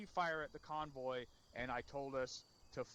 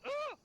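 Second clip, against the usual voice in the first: very high, loud mock squeal; short squeaky scream, mocking; squeaky yelp; 7 dB louder than usual. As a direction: imitate someone squeaking in alarm. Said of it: "don't make a squeaky noise like that".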